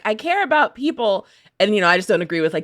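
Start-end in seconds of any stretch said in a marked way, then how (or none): 0.0-1.3 higher, timid mock of the governor; mock Lombardo. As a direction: peek out and say it sheepishly. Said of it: "peeks out and is like".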